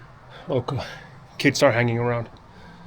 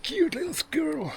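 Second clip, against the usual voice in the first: cooing at a squirrel; 8 dB quieter than usual. Real, not acted. Spots a squirrel, coos at it.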